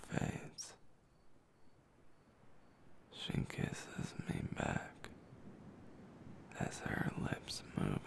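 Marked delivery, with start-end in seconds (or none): none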